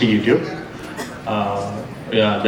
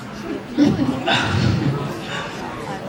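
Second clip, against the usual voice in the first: soft laugh; 4 semitones higher, 4 dB quieter than usual. Real, not acted. Laughs at hard question.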